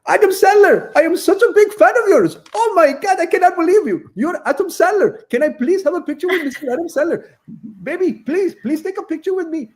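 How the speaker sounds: put-on excited fan accent. Host greeting Adam Sandler as a gushing fan, high and loud. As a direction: squeal it like a starstruck superfan. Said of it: "really animated and very excited with my accent".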